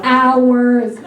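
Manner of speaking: normal talking voice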